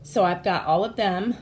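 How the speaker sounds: usual speaking voice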